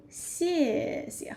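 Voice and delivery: 谢谢 with a slow, drawn-out falling tone. Shown as a demonstration. Teacher shows how to pronounce 谢谢, falling tone. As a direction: say 谢谢 slowly, tone dropping. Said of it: "Falling tone".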